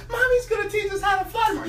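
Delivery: high, fast squeaky chick voice shouting with glee; a little eaglet too thrilled to sleep; excited baby eagle. Acted. Baby eagle tells siblings about tomorrow, high excited squeal.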